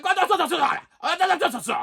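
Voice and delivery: very high mock-frantic agent; high-pitched imitation of a flustered foreign agent; imitated accented yelling. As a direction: gabble over the caller in a foreign accent. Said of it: "now he's yelling at me".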